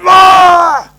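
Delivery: high loud startled shout of an angry teacher; expected yell. Retold or imitated. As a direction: blast the kid with a sudden yell. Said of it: "And you're expecting that".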